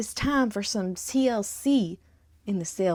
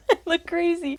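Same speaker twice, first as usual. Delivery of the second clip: laughing; 9 semitones higher, 3 dB louder than usual. Real, not acted. Laughing at own dyed hair.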